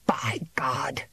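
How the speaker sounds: a breathless hissed curse; hissed oath. A narrator's reading. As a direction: hold the rage under your breath. Farmer's outburst begins hushed.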